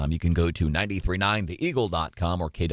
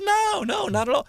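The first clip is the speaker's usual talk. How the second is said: very high-pitched imitation of a guest; imitated polite denial. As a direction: squeak out the retold reply way up high. Imitating guest's reply about her accent.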